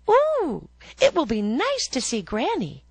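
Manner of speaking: put-on high, loud and thrilled little girl; bursting with glee at visiting granny; excited girl. A narrator's reading. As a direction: squeal it like a delighted child.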